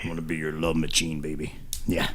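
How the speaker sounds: very deep, soft love-machine croon; sing-talk it low and creepy. Pretend. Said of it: "that's kind of a creepy voice".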